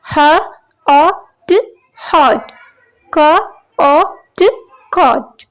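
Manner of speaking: "ह, अ, ट, hot" said sound by sound, then blended; sounding out words. Shown as a demonstration. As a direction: sound out "caught" as क, अ, ट. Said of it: "as in caught, as in hot".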